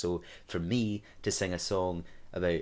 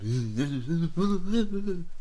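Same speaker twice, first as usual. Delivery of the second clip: mock DJ whoop; 11 semitones higher, 6 dB louder than usual. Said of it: "I was going".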